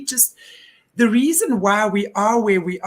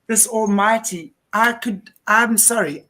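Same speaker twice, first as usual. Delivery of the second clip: normal talking voice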